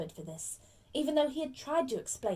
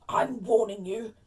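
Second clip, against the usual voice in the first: slow, loud, threatening uncle; menacing warning; 8 dB louder, slower than usual. Acted. Uncle taking his nephew aside, menacing warning. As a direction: loom over the boy with a heavy warning. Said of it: "putting his large purple face right up close".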